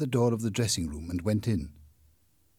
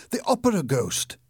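higher, louder cry of fright; terrified shout; 9 semitones higher, 6 dB louder than usual. A narrator's reading. Dancer at the party sees the ghost, terrified shout. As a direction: scream the alarm. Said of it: "shouted in a terrified voice".

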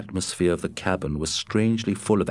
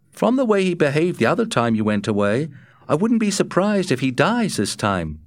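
higher, faster worried Matt; 8 semitones higher, 3 dB louder than usual. A narrator's reading. Matt warning Scott from his bunk, quick and higher. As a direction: push Matt's worry, quick and up. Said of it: "Matt remarked from his bunk".

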